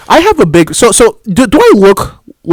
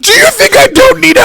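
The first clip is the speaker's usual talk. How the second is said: high laughing squeal; high mock indignation through laughter; mock outrage. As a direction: shriek it while cracking up. Mimicking offended coworker.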